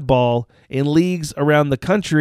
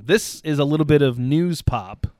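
usual speaking voice

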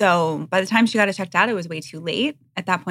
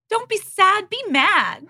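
very high, louder, feisty Southern mom imitation; mother's Southern accent; 5 dB louder, slower than usual. Mom rallying her sad daughter, imitated Southern accent. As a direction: urge it high in a Southern lilt. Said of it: "had a Southern accent".

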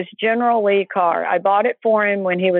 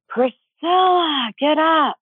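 imitated scream; 7 semitones higher, much slower than usual. Mom waking her daughter in the night, imitated screaming. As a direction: call out the name slowly and high, like a mother's alarm. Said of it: "I hear my mom screaming".

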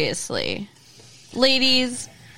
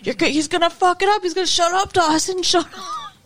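frantic fan; 8 semitones higher, faster than usual. Retold or imitated. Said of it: "he's like".

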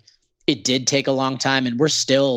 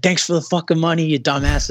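sarcastic on stage; 5 semitones higher than usual. Retold or imitated. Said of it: "on stage being like".

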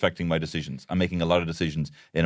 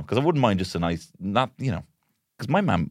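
ordinary talk

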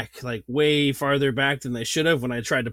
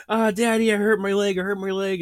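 put-on higher, lightly whining little kid; mock the kids' fake-hurt wail; a hint of a whimpering child; whiny kid; 7 semitones higher than usual. Mimicking his whining sons.

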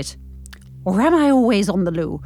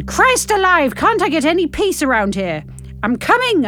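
high, exasperated call to the door; irritated roar; 7 dB louder, slower than usual. A narrator's reading. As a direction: call out, fed up, without full shouting. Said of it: "I roar".

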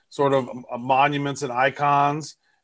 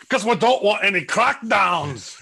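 higher put-on comic voice; comic character voice; 5 semitones higher than usual. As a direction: joke it in an exaggerated character voice. Comic put-on voice joking about crackdowns.

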